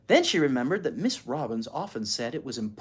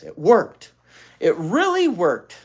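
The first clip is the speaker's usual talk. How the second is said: relieved; 4 semitones higher, 8 dB louder, slower than usual. A narrator's reading. Beezus's relieved thought.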